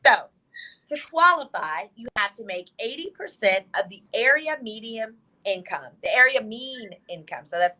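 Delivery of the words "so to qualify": deliberately slow, drawn-out "So to qualify"; slow it right down for the listener; half-speed, measured explaining. Shown as a demonstration. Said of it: "let me slow it down for you".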